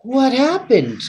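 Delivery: high startled cry; surprised cry. Acted. Toby landing flat on his back, startled cry. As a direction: blurt it out in bewilderment. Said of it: "Toby cried".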